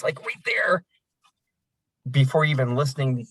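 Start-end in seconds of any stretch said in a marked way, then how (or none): 0.0-0.8 sudden whispered aside. Real, not acted. Trainer confiding to the class in a whisper. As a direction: drop to a voiceless whisper.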